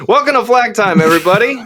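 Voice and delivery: upbeat show host intro; podcast intro, take two. Shown as a demonstration. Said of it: "can you do it without coughing".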